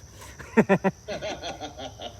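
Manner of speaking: loud uneasy laughter; nervous laugh. Real, not acted. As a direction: laugh off the tension. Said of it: "that was a nervous laugh".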